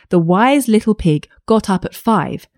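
ordinary delivery, nothing marked

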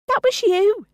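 very high-pitched squeaked protest; indignant squeak. Acted. Gina denying her sister's accusation, indignant squeak. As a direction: squeak it out in outrage. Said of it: "Gina squeaked".

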